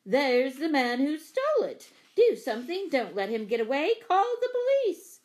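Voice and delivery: loud, high cry for help; frantic accusation. A narrator's reading. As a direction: shout in alarm, climbing to a shriek at the end. Frantic accusation, calling for police.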